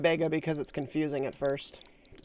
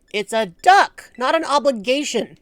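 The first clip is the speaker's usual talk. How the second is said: quoting a line; 5 semitones higher, 6 dB louder, slower than usual. Retold or imitated. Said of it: "Can I quote you on that?".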